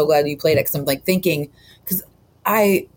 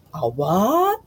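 higher, rising mock disbelief; sassy movie quote; 6 semitones higher than usual. Co-host admitting she says that line too, sassy imitation. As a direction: echo the sassy one-liner with an upswing.

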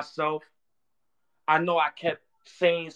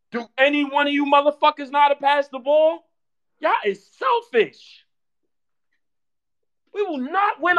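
raised, pitched-up berating of the team; loud high scolding; 11 semitones higher, 4 dB louder than usual. Real, not acted. Fan scolding the selfish players, loud and high. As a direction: scold them for not passing.

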